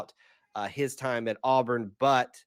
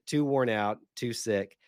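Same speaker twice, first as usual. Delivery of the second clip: normal talking voice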